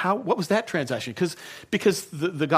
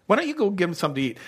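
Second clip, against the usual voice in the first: fast, higher, sarcastic shot; cynical Jesus; 5 semitones higher, much faster than usual. Retold or imitated. Pastor playing Jesus teasing complaining staff, sarcastic. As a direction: fling it back with a smirk. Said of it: "I attach to it my cynicism".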